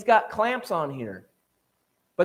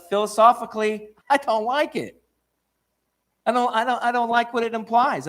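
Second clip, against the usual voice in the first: higher-pitched mocking take on a stubborn scientist; a sceptic's petulant complaint, played higher; mock Eddington; 6 semitones higher than usual. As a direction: poke fun at his stubborn refusal.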